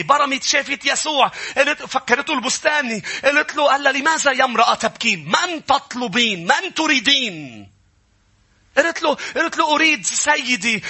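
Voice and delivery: fervent high-pitched sermon cry, driving each phrase home; impassioned preaching. Real, not acted. Preacher urging the congregation to hear the Lord's voice, impassioned preaching. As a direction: preach it high and forceful, voice held up at the top.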